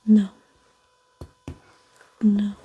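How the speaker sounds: usual speaking voice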